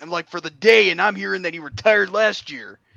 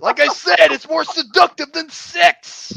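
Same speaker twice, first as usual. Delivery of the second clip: higher, slow drawled wrestling promo; Macho Man impression; 8 semitones higher, slower than usual. Wrestler friend doing a Macho Man promo, raised and slow. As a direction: growl it like a hyped promo.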